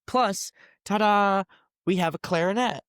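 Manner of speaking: a mother playfully unveiling the family clarinet; mock ta-da. Mom mock-announcing clarinet.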